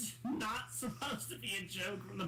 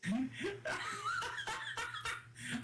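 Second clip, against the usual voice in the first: high, helpless burst of laughter; long high laugh; real laughter; 13 semitones higher than usual. Real, not acted. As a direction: crack up laughing in a high pitch. Laughs at the controller.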